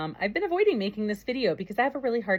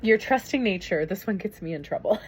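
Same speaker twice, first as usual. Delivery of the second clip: ordinary talk, nothing marked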